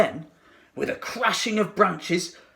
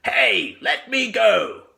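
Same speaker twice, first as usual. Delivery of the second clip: loud, slow, strained crocodile yell; a trapped beast bellowing to be let down; 6 semitones higher, 4 dB louder, slower than usual. A narrator's reading. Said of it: "yelled the enormous crocodile".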